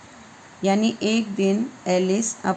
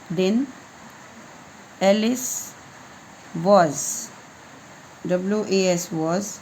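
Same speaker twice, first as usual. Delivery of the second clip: ordinary delivery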